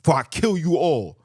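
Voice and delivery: loud, threatening captor; menacing threat. Retold or imitated. Conqueror threatening a mother, loud menacing. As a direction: bark the threat. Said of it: "they said".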